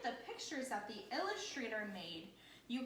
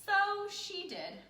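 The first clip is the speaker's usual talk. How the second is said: higher and louder refrain line; storybook refrain; 9 semitones higher, 6 dB louder than usual. A narrator's reading. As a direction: land the refrain for the children.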